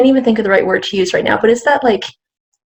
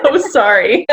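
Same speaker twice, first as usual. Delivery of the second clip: real laugh through a quick sorry; laughing apology; 5 semitones higher than usual. Real, not acted. Laughing off the beep that distracted her mid-answer. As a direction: giggle it out, embarrassed.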